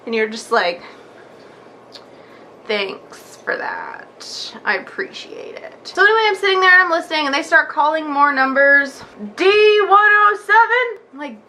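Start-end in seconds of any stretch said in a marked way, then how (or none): 2.7-5.7 very slow, soft, barely voiced thanks; deadpan sarcasm. Waiting patient answering the dental channel, soft and slow. 9.4-11.0 higher, slow clerk call; number caller. Retold or imitated. Clerk calling the next number to the room, higher and slow.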